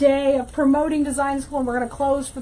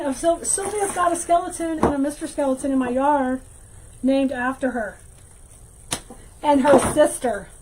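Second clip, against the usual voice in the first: normal talking voice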